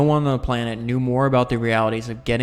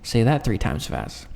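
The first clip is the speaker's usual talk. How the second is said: normal talking voice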